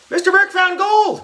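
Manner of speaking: put-on very high-pitched kid spreading news; high, thrilled cry; excited shriek. A narrator's reading. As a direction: squeal the news across the playground.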